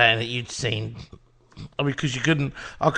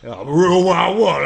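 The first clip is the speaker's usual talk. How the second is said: louder, slower, roaring boast; drunk rock-star boast; 3 semitones higher, 10 dB louder than usual. Retold or imitated. [name] roaring Wild Thing on the TV show.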